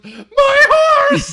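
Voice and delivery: very high, loud hammed-up wail, laughing; girl wailing for her horse, mock anguish; anguished shout. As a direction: scream for the lost horse. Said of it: "she goes".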